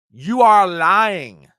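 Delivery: slow, loud, every word hammered; furious accusation. Real, not acted. Host accusing the president of lying, furious and slow. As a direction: spit it out at him.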